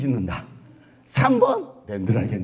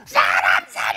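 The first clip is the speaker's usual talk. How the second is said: desperate shout for help; 6 semitones lower, 5 dB louder, slower than usual. Retold or imitated. A drowning man screaming for help. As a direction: yell it like you're drowning. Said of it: "물에 빠지면 수영 못하면".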